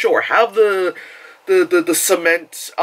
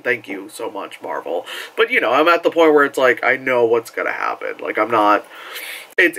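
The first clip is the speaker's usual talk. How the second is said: ordinary delivery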